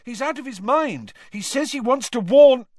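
high, pressing, rising to warn; Harry angrily insisting to Snape; angry urgency. Acted. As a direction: push through the anger. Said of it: "said Harry angrily".

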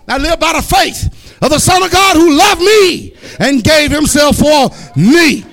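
slow, loud, high shouted preaching; preaching at full force. Acted. Preacher shouting scripture at the brothers, slow and loud. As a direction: thunder the scripture to the congregation.

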